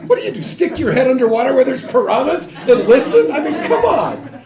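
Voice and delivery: high, loud laughing scoff; laughing disbelief. Real, not acted. Lecturer laughing at the idea of listening underwater. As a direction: laugh it off as absurd. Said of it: "mocking the piranha listening idea".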